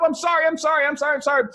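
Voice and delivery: high, loud, tumbling sorry; an assistant mortified, apologizing nonstop; frantic apology. Retold or imitated. Assistant apologizing to Colin Firth, frantic high voice.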